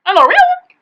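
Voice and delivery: high excited squeal. Real, not acted. Squealing reaction.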